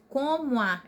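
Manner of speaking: COMO A joined as one word, co-mua; run together. Shown as a demonstration. Teacher showing poetic syllables, COMO A fused into one word. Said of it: "é como se fosse uma palavra só".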